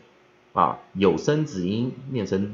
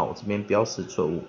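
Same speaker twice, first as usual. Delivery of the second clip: ordinary talk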